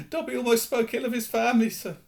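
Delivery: high, fast guilty elf voice; anxious house elf. Acted. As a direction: rush out the confession. Dobby confesses.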